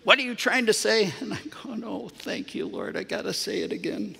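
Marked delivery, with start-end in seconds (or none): 0.0-1.1 a higher, sharp retelling of dad's startled question; a father jolted out of his silence; startled what. Retold or imitated. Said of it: "he turned back to me and he goes". 1.8-4.2 a much softer aside to God; muttered thanks under the breath; quiet prayer. Retold or imitated. Silent prayer while talking to dad.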